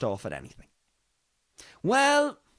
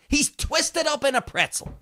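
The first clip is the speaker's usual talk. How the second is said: normal talking voice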